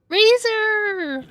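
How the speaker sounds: high, excited call-out; excited shout. Real, not acted. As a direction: squeal the name with delight. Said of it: "Sorry, I got excited".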